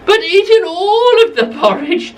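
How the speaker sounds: high, outraged little bear; Baby Bear voice. Acted. Narrator to children, Baby Bear finds his bowl empty, high and upset. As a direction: squeak it out high like a small upset cub. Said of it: "he said".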